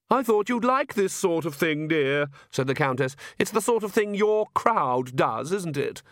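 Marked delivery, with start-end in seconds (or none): 0.1-2.3 high-pitched, needling society mother; prim countess mother. Acted. Countess needles her daughter about her friends, primly chiding. 3.4-6.0 high-pitched, needling society mother; prim countess mother. Acted. Countess needles her daughter about her friends, primly chiding.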